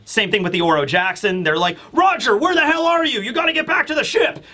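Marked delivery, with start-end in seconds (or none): 1.9-4.4 much higher, panicky call; frantic crewmate. Retold or imitated. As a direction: yell for the captain from the ship, pitched way up. Crew calling out for Roger.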